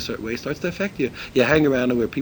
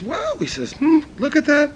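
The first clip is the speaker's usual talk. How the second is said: high-pitched acted wow; mock amazement, pitched way up; amazed; 13 semitones higher, 5 dB louder than usual. Visitor leafing through the art book, amazed.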